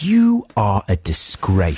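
slow, deliberate scorn; slow contempt. Retold or imitated. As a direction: spell out the insult very slowly. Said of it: "I said".

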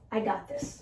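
whispered, self-assured; quiet confidence. Retold or imitated. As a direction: breathe it to yourself like a secret. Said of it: "what thought drives that feeling of certainty".

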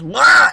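sudden loud Wah yell; cartoon battle cry. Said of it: "he just goes".

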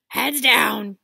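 very loud warning yell. Acted. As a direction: warn them urgently. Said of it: "yelled Hagrid".

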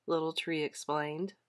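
ordinary delivery, nothing marked

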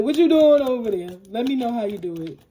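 hammed-up side-eye question; nosy mock question. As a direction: poke at them like a nosy onlooker. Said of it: "They're like".

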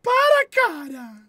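loud, slow, high drawn-out protest; exasperated outcry. Real, not acted. Streamer reacting to an absurd donation, drawn-out exasperated shout. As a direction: stretch each word out in disbelief.

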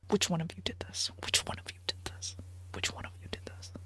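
a creepy, fading, accusing whisper; menacing whisper. Pretend. Accusing chat, mock menace.

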